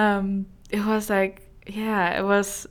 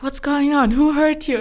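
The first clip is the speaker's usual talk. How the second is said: louder, higher worried questions; alarmed sister; 7 semitones higher, 7 dB louder than usual. Retold or imitated. Guest voicing her sister seeing her in tears, loud and alarmed. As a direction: rush in alarmed, asking who hurt her.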